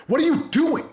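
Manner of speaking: high-pitched exasperated parent outburst; mad parent yelling. Acted. Dad telling kids off for being shitty, mock angry yell. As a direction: snap at the kids in a high, strained voice. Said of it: "we yell at them and we get mad at them".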